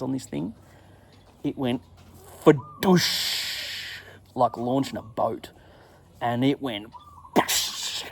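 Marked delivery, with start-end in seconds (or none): 2.4-4.0 long hissing splash noise; imitated splash. 7.3-8.0 short burst into a long hiss; explosive voiceless "boosh"; imitated splash.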